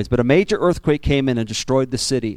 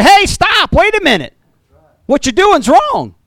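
mock rebuke; 5 dB louder than usual. Preacher acts out loving rebuke.

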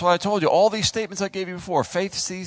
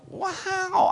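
very high mock amazement; high-pitched wow; awed reaction. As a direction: gape at the call in a high voice. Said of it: "then we're like".

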